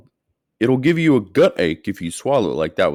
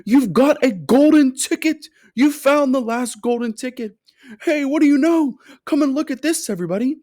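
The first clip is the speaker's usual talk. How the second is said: high, loud thrilled shopkeeper; excited scream; 5 dB louder than usual. A narrator's reading. Shopkeeper screaming to the whole shop about the golden ticket, high and loud. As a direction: scream it like he's about to have a fit. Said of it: "screamed the shopkeeper".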